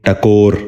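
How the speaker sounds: ٹکور with short a, "takor"; first vowel of ٹکور as short a; correct pronunciation. Shown as a demonstration. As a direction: say ٹکور as "ta-KOR". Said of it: "دوبارہ سنیے".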